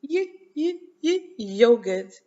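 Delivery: high, short y sounds on "Yo, yo", then "yogurt" whole; first sound repeated. Shown as a demonstration. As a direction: bounce the "yuh" sound before landing on "yogurt". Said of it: "Let's say it".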